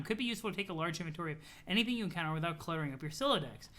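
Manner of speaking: ordinary talk, nothing marked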